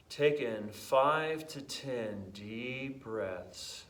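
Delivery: slow, hushed hypnotic guidance; guided meditation. Acted. Fitness coach guiding viewers into an alpha state, slow meditation voice. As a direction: ease the listener down slowly, with long pauses.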